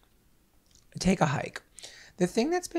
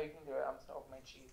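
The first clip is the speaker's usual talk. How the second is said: whispered aside; 2 semitones lower, 17 dB quieter, much faster than usual. Real, not acted. Aside while unwrapping snack.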